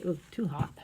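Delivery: usual speaking voice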